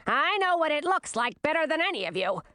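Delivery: high, boastful queen voice; put-on, high know-it-all mother; crowing. A narrator's reading. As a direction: crow over all of them. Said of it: "crowed Olivine".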